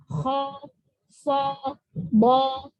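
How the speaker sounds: thick, half-rounded "Kho, so, bo"; heavy vowels, lips a bit forward, on "Kho, so, bo"; slightly forward lips. Shown as a demonstration. Teacher showing isti'la shape, thick forward vowels. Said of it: "agak maju".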